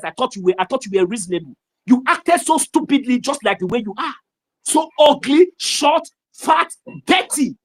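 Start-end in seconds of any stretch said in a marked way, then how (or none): 4.6-7.6 higher and louder, spitting out each insult; slowly, with disgust, list every flaw; contemptuous insults. Real, not acted. Scolding his critics, slow loud contemptuous insults.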